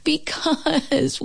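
high, drawn-out storybook wail. A narrator's reading. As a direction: stretch it into a whiny cry. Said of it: "wailed Toad".

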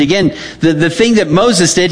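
ordinary delivery

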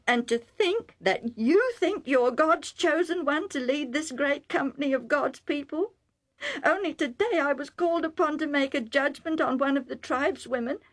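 a mother scolding her son in outrage; blurting mother. Acted. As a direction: let the disbelief burst out of her. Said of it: "Rebecca blurted out".

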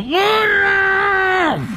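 high shouted call. Acted. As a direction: call the donkey from afar, high. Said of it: "Y gritó".

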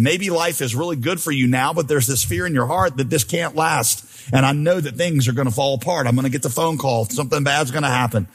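ordinary talk, nothing marked

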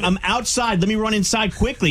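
high-pitched pretend panic; mock urgency. Prankster telling scammer he'll run inside, mock urgency. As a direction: act flustered and eager to comply.

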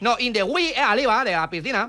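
put-on foreign accent with L for R; foreign-accented broken Spanish; mock foreign accent. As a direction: play a foreigner mangling Spanish, R turned to L. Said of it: "no entiendo yo de".

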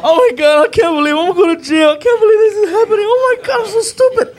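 high, loud panicked wail; near tears panic. Retold or imitated. Driver pulled over, panicking near tears. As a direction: wail in panic, nearly crying. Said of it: "I'm on the verge of tears".

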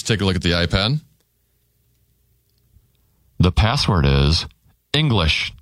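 low, confiding game host aside; hushed password reveal. Acted. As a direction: drop the voice low to tell the home audience the secret word.

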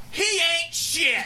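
high, drawn-out mock scream; retold heckler's scream. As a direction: imitate a woman hollering from the front row. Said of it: "she screamed out at the top of her lungs".

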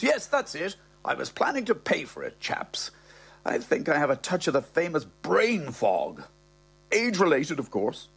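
put-on old fella talking his way out; flustered old man. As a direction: bluster out an excuse. Old man making up an excuse.

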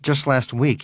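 ordinary delivery, nothing marked

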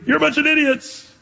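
imitation of an angry scream, held back; mock scream. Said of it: "do you scream".

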